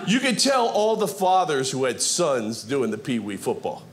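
ordinary delivery

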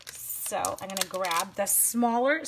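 ordinary talk, nothing marked

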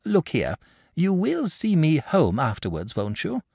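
insistent, weighty request; impressive. A narrator's reading. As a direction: press the point on the listener. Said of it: "said impressively".

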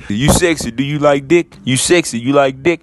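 low, loud hammed-up creep voice; deep mock flirt. Mocking quote repeated.

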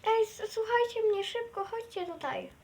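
high, rushed alarmed call; urgent alarm. Acted.

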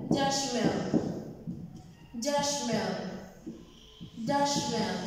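"dustman" said carefully as an English word; English word demo. Shown as a demonstration. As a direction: model "dustman" for learners. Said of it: "Basurero se dice".

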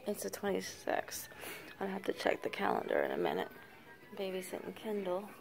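hush, keep it unvoiced; whisper. Real, not acted. Baby asleep nearby.

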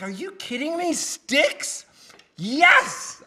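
high-pitched, excited disbelief building to a loud yes; thrilled reaction. Retold or imitated. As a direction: react like a fan offered a dream gig. Acts out own delight at offer.

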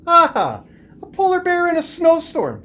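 high, loud delighted exclaim; loud playful teacher discovering a joke; excited teacher. Acted. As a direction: pounce on the idea with glee. Teacher voiced in story.

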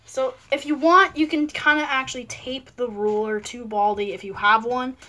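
ordinary delivery

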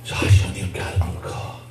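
low, hushed grumble to oneself; envious muttering. Acted. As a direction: mutter it almost voiceless, fading away. Acting out an envious person's grumbling.

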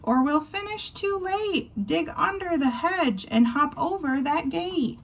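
high squeaky mouse hurrying a bunny; high mouse voice, impatient. Acted. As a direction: squeak it out, urging him on. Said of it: "Marvin squeaks".

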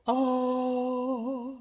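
same note, lifted into head voice. Shown as a demonstration. Coach demonstrating the same note, head version. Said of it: "that same note in the head voice".

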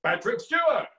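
big, loud impression, pitched well up; Patrick Stewart. Shown as a demonstration. Answering mom's question, a loud high actor impression. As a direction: proclaim the name theatrically high and loud. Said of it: "to do a Patrick Stewart impression is to say".

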